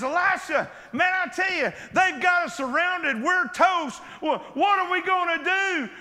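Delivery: a scared servant pleading with Elisha; frantic servant. Acted. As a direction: servant panicking that they are surrounded. Frantic servant voiced in story.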